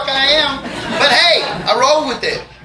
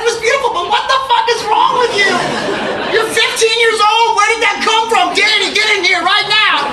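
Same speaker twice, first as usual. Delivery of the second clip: much higher, laughing mom voice; shocked mom; 10 semitones higher than usual. Retold or imitated. Mom reacting to his poem, shrill and laughing. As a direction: squeal it as his horrified mother. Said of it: "She's like".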